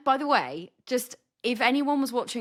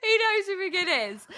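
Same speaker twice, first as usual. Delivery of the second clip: excited; 9 semitones higher than usual. Real, not acted.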